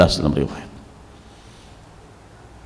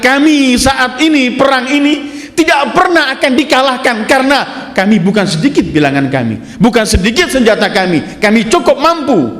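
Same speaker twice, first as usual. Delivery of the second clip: high, emphatic imitation of boasting troops; retold boastful shouting; 7 semitones higher than usual. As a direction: voice the army's overconfident cry, pitched high. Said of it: "Mereka mulai teriak".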